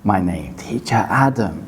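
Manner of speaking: slow, hushed whisper of the name; whispered demo. Shown as a demonstration. Teacher showing how a class whispers his name, whispering. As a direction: drop into a partial whisper. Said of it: "where we would whisper".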